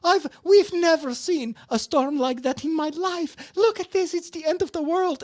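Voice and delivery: high, loud hammed-up panic; mock panic. Neighbor panics over her storm video, hammed-up high cry. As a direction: play the frantic neighbor at the end of the world. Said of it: "she's totally panicking".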